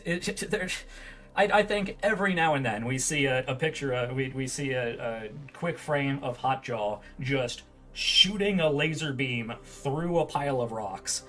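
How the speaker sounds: usual speaking voice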